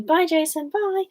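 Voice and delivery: high, lilting pretend farewell; acted friendly goodbye. Demonstrating how to use someone's name, high acted goodbye. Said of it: "when you go and say".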